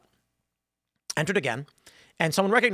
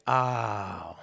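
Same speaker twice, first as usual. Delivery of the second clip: low, drawn-out imitated "oh"; long sinking tone; deflated realization; 8 semitones lower, 4 dB quieter than usual. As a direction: mimic someone sinking into sudden understanding. Deflated reply of other player.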